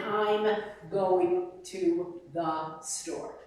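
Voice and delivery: "I'm going to the store" slow and legato, one line; медленно и слитно, без движения губ; smooth Italian-style flow. Shown as a demonstration. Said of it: "They can't imagine".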